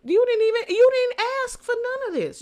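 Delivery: loud and high, driving the point; emphatic. Real, not acted. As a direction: hit it hard and high. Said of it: "emphatic rebuke".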